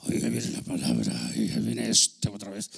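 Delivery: very low, loud-breathed grumble of a fed-up listener; mock weary grumbling. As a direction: groan it out low, as if weighed down. Mimics listeners weary of the word.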